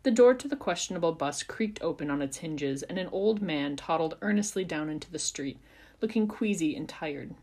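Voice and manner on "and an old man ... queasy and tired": ordinary delivery, nothing marked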